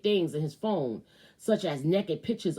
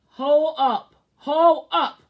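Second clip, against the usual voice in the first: louder, higher stop of disbelief; shocked hold up; 7 semitones higher, 9 dB louder, much slower than usual. Real, not acted. Stopping the reading in shock, loud and higher. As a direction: cut in loud and high, stunned.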